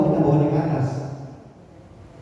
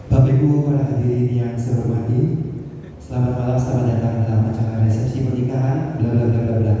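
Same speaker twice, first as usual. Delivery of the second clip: low, measured, even ceremonial greeting; formal MC intonation; 7 semitones lower than usual. Shown as a demonstration. MC greeting guests at a wedding reception, formal intonation. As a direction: welcome them formally, pitched low and steady. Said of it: "Nah, itu adalah intonasi".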